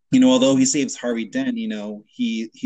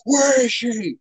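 high-pitched strained mock yell; imitated Batman scream; 10 semitones higher, slower than usual. Friend retelling Batman demanding Rachel's whereabouts, mock scream. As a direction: yell it like Batman, without full volume. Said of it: "Batman's screaming".

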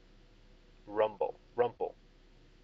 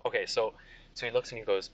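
normal talking voice

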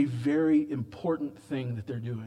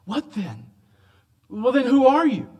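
demanding question; 8 semitones higher, 5 dB louder than usual. Retold or imitated. Delegation questioning John.

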